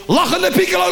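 high threatening call; mock shout. Boy threatening his brother, calling out loud. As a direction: threaten to yell it through the house. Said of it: "ga ik keihard roepen".

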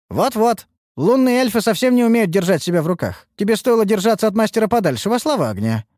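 high, righteous telling-off; heated, high-pitched lecture; scolding rival. Acted. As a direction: pounce on it with haughty reproach. Rival scolds the fallen elf.

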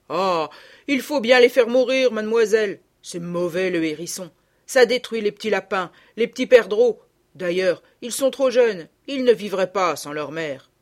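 loud rustic gamekeeper, annoyed. A narrator's reading. Gamekeeper scolding the young ladies, loud and rustic. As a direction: grumble loudly like a country keeper.

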